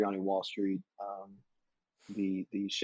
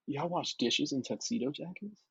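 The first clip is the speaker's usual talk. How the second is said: put-on higher, eager voice of a green kid; naive thrill; 6 semitones higher than usual. Young dishwasher thrilled by a tuxedo jacket, eager and higher. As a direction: sound thrilled and clueless about the job.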